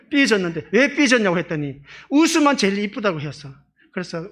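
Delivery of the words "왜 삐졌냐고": higher, louder playful question; calling out. Retold or imitated. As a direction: ask the sulker out loud. Asking the sulking sister. (크게 높여 묻는 장난스러운 목소리)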